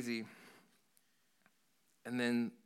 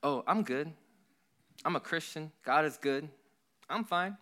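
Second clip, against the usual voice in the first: bright, higher mock-cheerful denial; higher mock "I'm fine" brushing it off; fake fine; 6 semitones higher, 3 dB louder than usual. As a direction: pretend all is well, a bit too brightly. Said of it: "you guys ever feel like".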